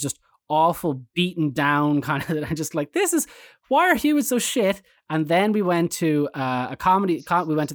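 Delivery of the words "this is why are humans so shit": 1 exasperated friend. Retold or imitated. John's exasperated quote at museums.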